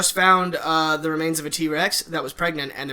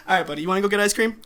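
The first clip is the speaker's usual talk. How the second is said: ordinary talk, nothing marked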